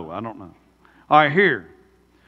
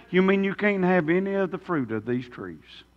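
softer, sly mock Satan asking the question; Satan's twisting question; 4 semitones lower, 9 dB quieter than usual. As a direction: slip the question in quietly, like the serpent. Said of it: "You know what Satan said".

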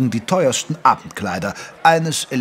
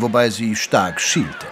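ordinary delivery, nothing marked

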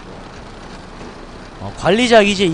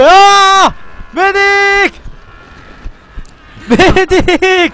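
calling for a medic, high and loud; man down shout; 10 semitones higher, 6 dB louder, much slower than usual. Real, not acted. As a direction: yell for the medic, drawn out.

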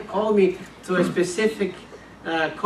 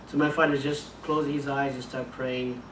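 usual speaking voice

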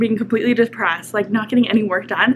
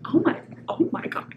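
whispered gasp from the heat; breathless and burned; 3 semitones higher, 6 dB quieter than usual. Real, not acted. Burning from hot sauce.

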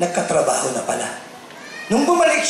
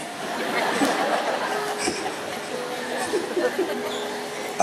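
breathy laugh; 7 semitones higher, 8 dB quieter than usual. Real, not acted. Preacher laughs at own joke.

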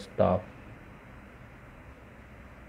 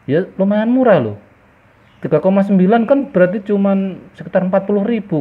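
excited; 10 semitones higher, 9 dB louder than usual. Real, not acted. Cheap prices at the market.

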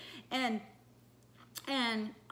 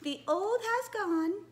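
high, slow princess voice; sing-song princess, stretching each word; 8 semitones higher, slower than usual. Pretend. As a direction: give your very best princess. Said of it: "very best princess voice".

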